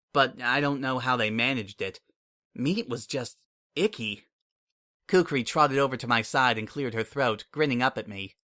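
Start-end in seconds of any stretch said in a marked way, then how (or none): none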